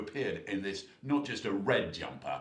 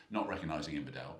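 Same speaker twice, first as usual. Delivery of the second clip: ordinary delivery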